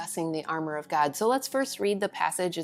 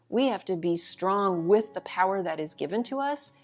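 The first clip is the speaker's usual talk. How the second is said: normal talking voice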